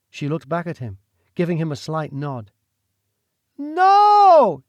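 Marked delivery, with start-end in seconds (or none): 3.6-4.6 a high, loud defiant no; shout. Acted. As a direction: scream your refusal at the duke. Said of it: "she shouted".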